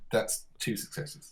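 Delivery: breathless gasp. Real, not acted. Tense dice roll reveal.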